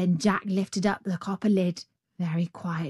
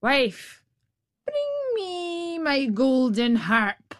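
slow, high ogre demand; ogre calling out; 6 semitones higher, much slower than usual. A narrator's reading. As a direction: call the wife to fetch it. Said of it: "the ogre called out".

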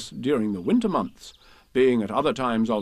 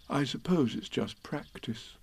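softer, slightly dim bear voice; lightly voiced Pooh, musing; 3 semitones higher, 7 dB quieter, slower than usual. Pooh puzzles to himself in a corner, softly musing. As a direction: think aloud quietly, a little puzzled.

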